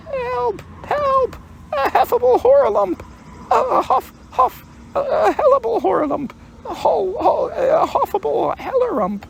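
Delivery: very high squealed panic, words tumbling into muddle; Piglet crying out. A narrator's reading. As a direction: shriek breathlessly as a fleeing little piglet. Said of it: "still crying out".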